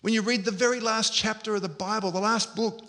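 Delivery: ordinary delivery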